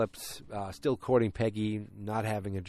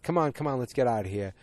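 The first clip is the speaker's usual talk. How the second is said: retold urging, higher and louder at first; 4 semitones higher, 5 dB louder than usual. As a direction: coax someone along, lightly acted. Urging someone to leave in retold scene.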